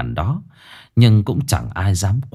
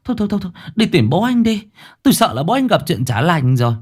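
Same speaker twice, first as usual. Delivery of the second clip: high, fast, fretful mother-in-law voice; an old woman urging her son to find his father; worried old mother; 9 semitones higher, faster than usual. Acted. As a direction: hurry him off in a higher, anxious rush. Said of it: "mẹ chồng nói vậy".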